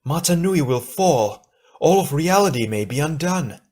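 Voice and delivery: high, pressing warning; urgent. A narrator's reading. Gali warns Tahu that all may be undone, urgently. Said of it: "she said urgently".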